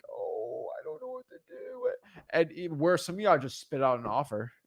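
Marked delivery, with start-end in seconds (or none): 0.1-1.9 soft, high, helpless whimper; soft, high mock helplessness; timid caller. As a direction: shrink back, lost. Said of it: "curl up sometimes and be like".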